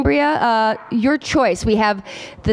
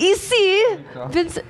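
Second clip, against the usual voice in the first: mock French; 7 semitones higher, slower than usual. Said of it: "a little bit of French".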